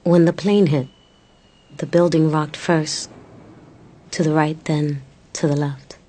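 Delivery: low, measured poem narration; narrating the poem of a woman on the hundred and fourth floor; poem reading. Acted.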